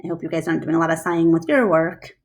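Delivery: ordinary delivery, nothing marked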